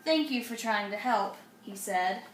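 lively storybook reading; reading to children. A narrator's reading. Mom reads a picture book aloud to kids. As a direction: read it brightly to little ones.

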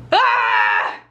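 loud low shout of despair; frustrated shout. Real, not acted. Friend giving up on the story, a loud frustrated shout. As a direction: cry out in exasperation.

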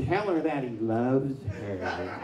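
slow, sarcastic, with a laugh; sarcastic laughing aside. Retold or imitated. Preacher recalling his sarcastic remark to his wife, laughing. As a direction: drip sarcasm while laughing.

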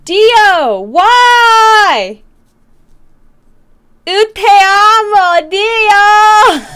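high, loud, drawn-out wail; overwhelmed cry out. Real, not acted. Streamer overwhelmed by Dio's support, loud real wail. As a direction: wail it out at full volume, high-pitched.

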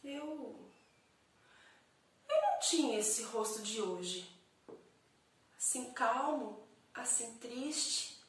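slow theatrical soliloquy with long pauses; dramatic poem recital. Acted. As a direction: recite it as an introspective monologue, swelling on key phrases. (declamação dramática de poema, pausada)

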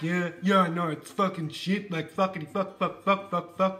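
put-on muttering, sweary old man, rising to a final outburst; John agreeing crankily. Husband backs up his wife's complaint, grumbling crankily. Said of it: "John was like".

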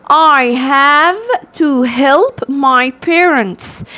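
slow, stretched reading of the line; قراءة بطيئة. Shown as a demonstration. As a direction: read it slowly for the class. Reads character's line aloud. (قراءة جملة الشخصية ببطء)

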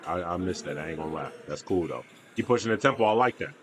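ordinary talk

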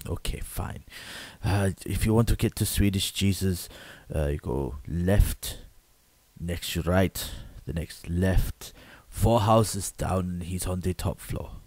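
put-on low, slow accented directions; resigned foreign local. Local directing the man to Swedish Jesus, low slow accented voice. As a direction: spell out the route slowly, step by step.

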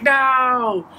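long, high drawn-out cry of dismay; anguished wail. Acted. Coyote watching birds take all the meat, anguished wail. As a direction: wail it in mock despair.

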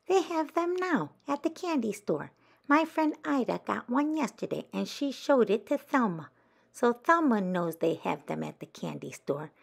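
put-on chirpy child telling the news; Gloria's voice.

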